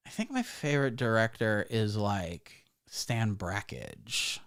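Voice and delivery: quiet, low, slow mock hipster drawl; pretentious art girl. Comic mocking a Brooklyn film snob, quiet and low. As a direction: deflate each word like a bored film snob. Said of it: "a woman who's like".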